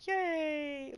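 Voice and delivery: high, hollow mock cheer; high sarcastic yay. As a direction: fake delight to mock it. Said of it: "I'm just like".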